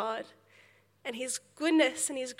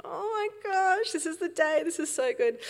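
excited thought; 6 semitones higher than usual. Retold or imitated. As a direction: gasp it out like a kid thrilled for the big day. Excited thought before swimming lesson.

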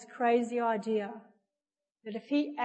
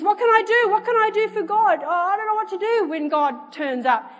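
high, loud, flustered babble; mock freaking out; 10 semitones higher, 9 dB louder than usual. Counter lady at a loss before God, mock freaking out. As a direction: play up the panic, pitched right up. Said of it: "freaking out".

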